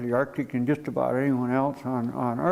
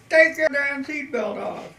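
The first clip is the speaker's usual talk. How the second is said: high, drawn-out mock holler; exasperated order; 12 semitones higher than usual. Crash companion orders trapped man to free himself, exasperated holler. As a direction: holler it slowly, pitched well up.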